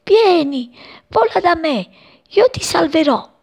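a hint of a kind sky calling; sky inviting. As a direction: call the bird to freedom. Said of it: "pareva dire".

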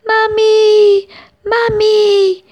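high, loud exhausted chick crying mummy; very high frightened shout. Acted. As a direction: call out as strength fails. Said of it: "lui gridava".

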